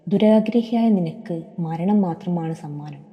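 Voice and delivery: ordinary delivery, nothing marked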